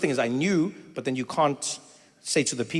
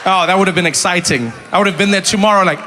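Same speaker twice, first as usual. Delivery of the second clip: ordinary talk, nothing marked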